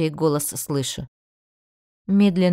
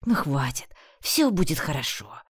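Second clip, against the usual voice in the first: soothing whisper; 5 semitones lower than usual. A narrator's reading. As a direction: hush her gently, almost whispering. Bran comforts crying heroine.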